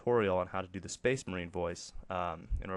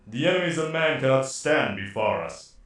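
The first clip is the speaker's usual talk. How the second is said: loud, distorted commanding soldier; Space Marine voice; 4 semitones higher, 10 dB louder than usual. Shown as a demonstration. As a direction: boom it like an armored warrior. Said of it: "That was really bad".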